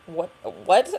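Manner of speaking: confused, rising "what, what"; imitating her own shocked reaction; baffled double take. She repeats the question in disbelief to the doctor. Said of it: "And I was like".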